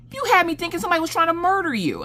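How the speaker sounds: high, fast mock-incredulous rant; hammed-up exasperated squeal; retold exasperation. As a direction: squeal the scolding out in a rush. Husband's exasperated quote, voiced high.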